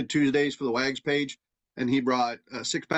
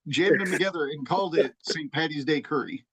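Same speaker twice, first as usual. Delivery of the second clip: fast, loud, raised exasperated rant; 4 semitones higher, 5 dB louder than usual. Retold or imitated. Telling Cookie his curry makes no sense, fast exasperated rant. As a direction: rant at him in disbelief, words tumbling out. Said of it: "I was like".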